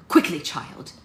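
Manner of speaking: loud, sharp snap; snapped order. A narrator's reading. Lilith cuts off Prudence's protest, snapping. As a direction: cut her off impatiently. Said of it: "Lilith snapped at her".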